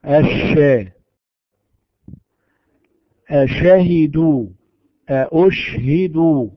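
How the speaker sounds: أشهدوا with a drawn, spreading sh. Shown as a demonstration. Teacher showing the sh spread in example words. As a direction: stretch the sh in أشهدوا slowly. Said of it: "فيقال".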